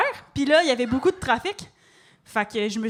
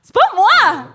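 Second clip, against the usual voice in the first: loud denial; 7 semitones higher, 9 dB louder, slower than usual. Real, not acted. Heated denial.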